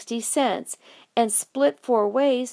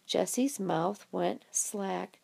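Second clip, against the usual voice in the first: ordinary delivery, nothing marked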